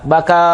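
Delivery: final "a" of "Baka" held for two counts; two-beat long vowel. Shown as a demonstration. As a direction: stretch the last vowel of "Baka". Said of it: "dua harokat". ("Baka" dibaca panjang dua harokat di akhir)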